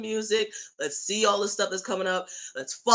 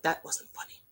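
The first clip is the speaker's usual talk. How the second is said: sulky under-the-breath whisper; pouty whisper; 8 dB quieter than usual. Retold or imitated. Upset fan driving off after the joke, whispered pout. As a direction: mutter it to yourself, pouting.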